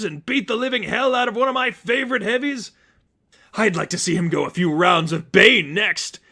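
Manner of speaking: high, loud manic Joker; cackling madman, gleeful and loud. A narrator's reading. Joker gloating to Batman about the Seraphim, high manic voice. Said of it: "The madman laughed hard".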